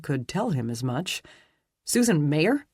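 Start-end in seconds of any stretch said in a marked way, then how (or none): 1.9-2.7 rising, startled question; surprised. A narrator's reading. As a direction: caught off guard, echoing the name. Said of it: "surprise entering his voice".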